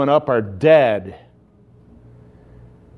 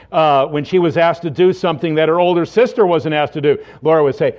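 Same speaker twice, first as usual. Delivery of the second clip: ordinary talk, nothing marked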